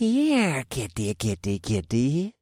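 mocking kitty, kitty call; taunting. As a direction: coax him out, mocking. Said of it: "one of the cultists taunted".

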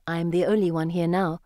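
usual speaking voice

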